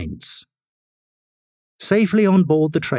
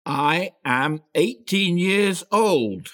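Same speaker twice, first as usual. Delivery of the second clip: slow, higher, deliberate insistence; firm repeat; 6 semitones higher, much slower than usual. A narrator's reading. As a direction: say it firmly, word by word. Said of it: "repeated firmly".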